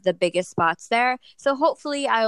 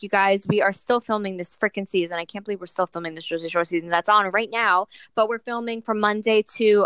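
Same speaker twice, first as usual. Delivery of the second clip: ordinary talk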